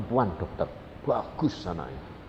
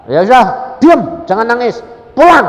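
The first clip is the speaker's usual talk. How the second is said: high, loud, curt commands; shouted orders; 11 semitones higher, 15 dB louder than usual. Retold or imitated. Preacher sending the crying woman home, shouted orders. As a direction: fire off each order at a shout.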